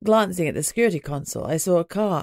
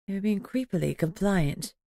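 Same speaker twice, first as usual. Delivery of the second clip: usual speaking voice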